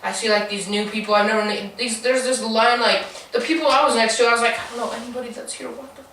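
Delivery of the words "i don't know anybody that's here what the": hushed, higher self-talk; very soft retold confusion; muttered bewilderment. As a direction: mutter it anxiously to yourself. Retold bewildered thought in line.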